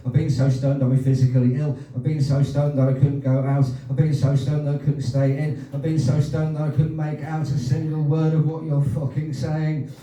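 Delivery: loud, driving list in repeated rhythm; performance poem. Acted. Poet answering another performer's poem, loud rhythmic recital. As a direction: belt out each line to the same beat.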